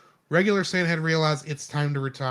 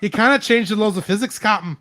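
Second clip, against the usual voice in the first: loud Scottish engineer warning the captain; Scottish accent, urgent and raised; Scotty Scottish accent; 5 semitones higher, 6 dB louder than usual. Pretend. Scottish engineer shouts his warning to the captain in Scottish accent. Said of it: "my Scotty from Star Trek".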